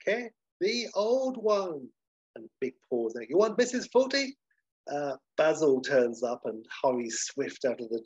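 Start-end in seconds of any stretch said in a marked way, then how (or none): 0.0-1.9 put-on high, stretched THE OLD ONE; slow, drawn-out repeat. Dealer spelling it out to the waiter, slow high "the old one". 3.3-4.4 high-pitched incredulous question; puzzled waiter. Acted. Waiter misreading who the dealer wants, high baffled question. Waiter misunderstands "the old one.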